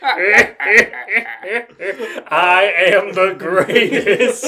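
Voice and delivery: slow, loud boast; mock grand boast; hammed-up boast. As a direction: proclaim it slowly like a champion. Re-performs the boast laughing.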